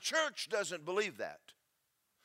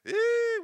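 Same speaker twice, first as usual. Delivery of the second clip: mock squeal; 9 dB louder than usual. Mock panic at the law.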